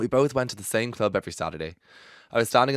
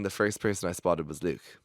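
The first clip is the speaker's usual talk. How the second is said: ordinary talk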